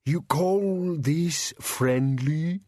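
high, very slow monster complaint; drawn-out creature grumble; aggrieved bogeyman. Acted. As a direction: protest slowly as a pinned bogeyman.

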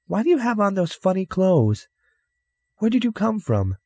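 put-on very high child's voice; curious little girl. Girl quizzing an oddly dressed stranger, high curious voice. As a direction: pepper him with a child's questions.

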